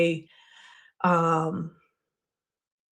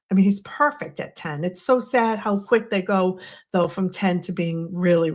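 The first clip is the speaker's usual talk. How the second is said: ordinary talk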